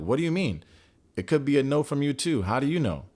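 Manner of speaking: retold comeback, acting put out; mock indignation. He challenges her over hearing a no, mock indignant.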